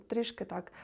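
ordinary delivery

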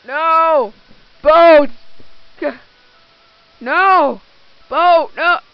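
loud, high panicked shout; shouting in dismay. Real, not acted. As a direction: yell after the departing boat. The boat leaves without them.

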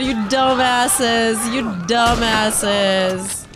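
slow drawn-out shout; slow motion. Real, not acted. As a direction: stretch every word, yelling. Yelling at stupid enemies.

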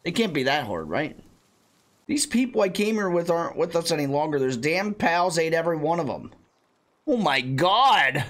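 normal talking voice

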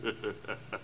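quiet, low, amused villain's laugh; soft sinister chuckle. Acted. Killer chuckling when the woman guesses his plan. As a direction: chuckle as if caught and proud of it.

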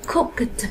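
"cooked" said softly with k, then a released t; k followed by t. Shown as a demonstration. Teacher explains k goes with t, "cooked" ending in k-t. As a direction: finish "cooked" on separate k and t puffs. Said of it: "âm cờ thì sẽ đi với âm tờ".

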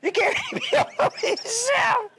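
laughing through the line, pitch up high. Real, not acted. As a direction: break into a laugh mid-sentence. Preacher laughs at own joke.

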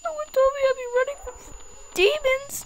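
high frantic crying shout; panicking gamer. Real, not acted. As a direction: panic while running for your life. Running from demon.

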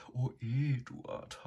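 high, slow dreamy sigh; breathed. A narrator's reading. Sow swooning over Eduard in his dream, breathy high sigh. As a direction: breathe his name, lovestruck. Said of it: "hauchte sie".

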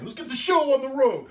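high, loud announcer-style call; big showman shout to start; hype call. Real, not acted. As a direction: kick off the show like a ringmaster. Hyping up the start of painting.

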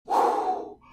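usual speaking voice

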